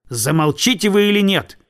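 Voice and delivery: narrated cry of irritation, not full volume; irritated cry. A narrator's reading. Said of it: "крикнул крестьянин".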